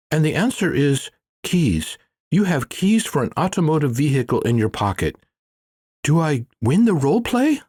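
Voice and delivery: a keen pupil proudly giving his answer; Manjunath, eager. A narrator's reading. Manjunath eager, voiced higher.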